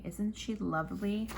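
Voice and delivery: usual speaking voice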